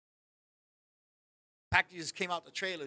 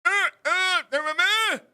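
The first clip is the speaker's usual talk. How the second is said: high, loud mock cries; imitated deaf man's protest; 9 semitones higher, 6 dB louder than usual. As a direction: blurt mimicked, half-formed words. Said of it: "this guy is deaf".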